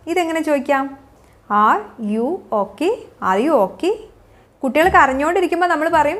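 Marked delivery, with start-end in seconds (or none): 1.4-2.9 slow, low, separated words. Shown as a demonstration. Teacher showing learners how to check on someone, word by word. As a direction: leave gaps between "Are", "you" and "okay". Teacher models question slowly, then joined.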